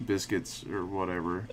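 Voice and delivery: usual speaking voice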